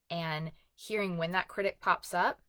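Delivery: usual speaking voice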